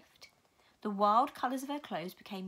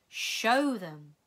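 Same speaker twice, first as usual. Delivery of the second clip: commanding; 8 dB louder than usual. Acted. Mrs Which commands.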